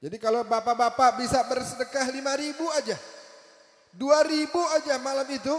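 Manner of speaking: high, loud, rousing sermon delivery; full-force preaching. Acted. Preacher urging the congregation to give and pray, rousing and loud. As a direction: preach it out with fervour to the crowd.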